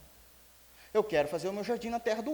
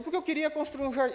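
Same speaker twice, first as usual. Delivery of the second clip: put-on pitched higher, whiny child's complaint; king sulking like a child over his garden; sulky little kid; 8 semitones higher than usual. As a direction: whine it like a pouting boy. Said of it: "é um quico, não é?".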